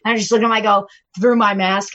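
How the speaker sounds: usual speaking voice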